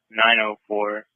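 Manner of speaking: ordinary delivery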